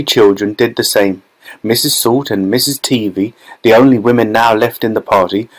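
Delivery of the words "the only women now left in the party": ordinary delivery, nothing marked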